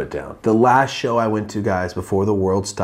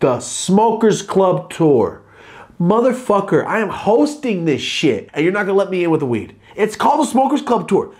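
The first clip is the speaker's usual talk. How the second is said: much higher and louder mock rant at security; retold furious yelling; 9 semitones higher, 4 dB louder than usual. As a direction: yell it like you're being refused at your own show. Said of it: "I got fucking pissed".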